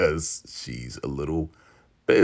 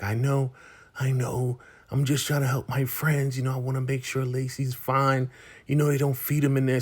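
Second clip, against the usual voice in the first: higher-pitched, mildly pleading imitation; mock pleading; 5 semitones higher, 3 dB quieter than usual. [name] explaining to Ruth why she risks punishment, mock pleading. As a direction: plead lightly in a raised pitch.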